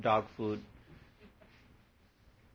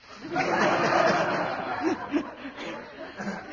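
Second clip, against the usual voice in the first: long breathy high-pitched laugh; 13 semitones higher, 4 dB louder than usual. Real, not acted. Swami laughing at his own joke to the audience, high and breathy. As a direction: let out a long airy laugh.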